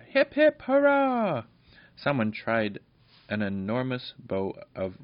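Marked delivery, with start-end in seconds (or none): 0.1-1.4 much higher, louder hip hip hurrah; crowd hurrah. Acted. Fairy crowd cheers the goblin dog. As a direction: shout it like a whole crowd.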